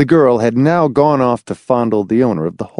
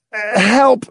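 pleading; 11 semitones higher than usual. Acted. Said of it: "Hamilton appealed".

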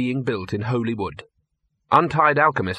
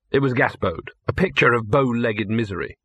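usual speaking voice

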